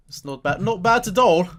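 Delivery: high, loud, badly put-on foreign accent on It's not bad; terrible accent. Shown as a demonstration. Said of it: "It's my terrible accent".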